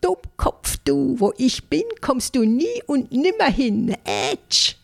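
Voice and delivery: high, cheeky singsong taunt; a tiny ant teasing its hunter. Acted. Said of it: "hörte ich die atemlose Ameise mit hoher Stimme rufen".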